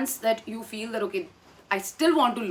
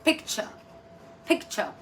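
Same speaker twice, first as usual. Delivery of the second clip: British picture; 4 dB quieter than usual. Shown as a demonstration. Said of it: "It's the U K way of saying it".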